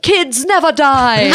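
put-on high, drawn-out heroine cry with Swiss accent; Mercy. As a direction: blurt it as a mock-heroic catchphrase. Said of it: "And I was like".

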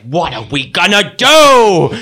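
slow, loud dramatic question; milk it before the commercial break; mock dramatic cliffhanger. Remini mimicked.

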